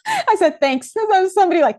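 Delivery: loud, high laughing talk. Real, not acted. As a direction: laugh while explaining. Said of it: "laughing at spirit voice".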